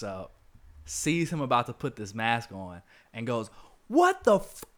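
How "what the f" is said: shocked outburst. Retold or imitated. Aunt May catches him suited up.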